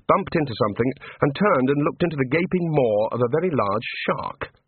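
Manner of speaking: plain reading voice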